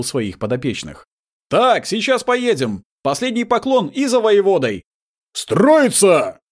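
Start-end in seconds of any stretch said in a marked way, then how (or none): 1.5-4.8 calling out orders. Acted. Commander rallying his troops. 5.3-6.4 barked military order, high and loud; shouted command. Acted. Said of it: "громко крикнул Прам".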